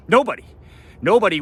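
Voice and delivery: high, loud one-word answer; emphatic shout. Real, not acted. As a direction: bark it out as the final verdict. Answers his own rhetorical question.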